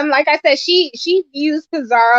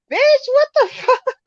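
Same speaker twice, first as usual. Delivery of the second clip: high mock yell, drawn out; retold yell; 11 semitones higher than usual. Retelling the school bully's challenge, high mock yell. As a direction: yell it like the bully in your face. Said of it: "stood over me and was yelling".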